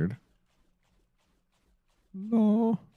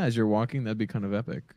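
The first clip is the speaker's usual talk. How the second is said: ordinary talk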